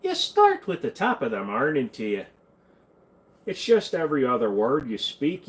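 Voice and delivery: lilting put-on Irish accent; mock Irish lilt with bent vowels. Shown as a demonstration. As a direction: demonstrate an Irish accent, slipping towards Scottish. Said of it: "trying to practice my Irish".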